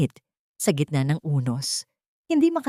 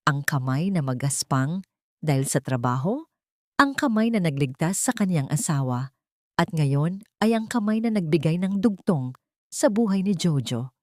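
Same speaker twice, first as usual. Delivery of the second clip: ordinary delivery, nothing marked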